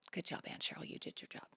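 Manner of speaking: whispered praise under the breath; whispered thought. Retold or imitated. Quietly praising Aunt Cheryl in her head, whispered. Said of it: "I thought".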